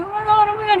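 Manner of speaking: a frantic teary wail of disbelief; crying panic. Retold or imitated. On the phone with boyfriend beside the wrecked car, crying. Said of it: "I was still crying".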